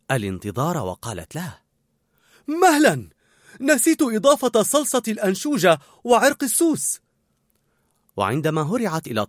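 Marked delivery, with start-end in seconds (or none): 2.5-7.0 high, loud halt; urgent calling. A narrator's reading. Cook halting the waiter, urgent calling. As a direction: call out to stop someone leaving.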